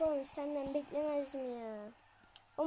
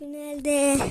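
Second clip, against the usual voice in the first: loud; 12 dB louder than usual. Real, not acted. Excited final announcement.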